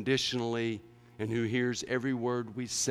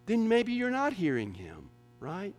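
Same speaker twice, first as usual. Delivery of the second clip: louder, driving sermon voice; full-force preaching; 7 semitones higher, 9 dB louder than usual. Real, not acted. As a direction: preach it hard to the congregation.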